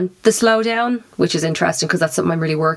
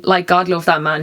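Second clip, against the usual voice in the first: ordinary talk, nothing marked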